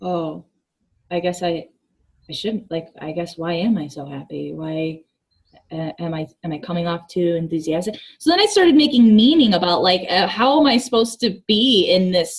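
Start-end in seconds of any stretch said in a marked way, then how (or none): 0.0-8.0 unsure reply. Retold or imitated. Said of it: "And I was like".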